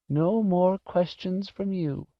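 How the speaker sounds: put-on high, slow old-lady murmur; old woman's drawn-out, sorrowful voice; grieving murmur. A narrator's reading. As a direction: murmur it slowly, pitched high, to the lost pet. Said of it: "she finally murmured".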